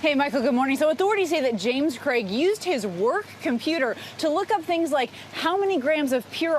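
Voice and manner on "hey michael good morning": ordinary talk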